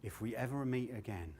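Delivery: hushed, confiding retold line; softly mimicked parting whisper, falling away; imitated whisper. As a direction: say it low and soft, as if into an ear. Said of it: "he whispers in my ear".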